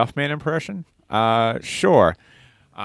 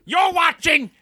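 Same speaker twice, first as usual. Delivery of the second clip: much higher, slow, shouted announcer squawk; Gilbert Gottfried voice; slower than usual. Pretend. Back in the Gilbert Gottfried voice, shouting a TV bumper. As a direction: screech the promo like Gilbert Gottfried. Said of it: "What a weird quote".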